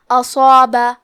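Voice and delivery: اصابہ with a heavy, full-mouthed ص and heavy long alif; اصابہ, saad thick and alif stretched heavy. Shown as a demonstration. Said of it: "صاد کو اور مد الف کو دونوں کو بھاری آواز". (اصابہ میں صاد اور الف بھاری آواز میں)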